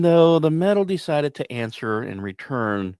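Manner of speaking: usual speaking voice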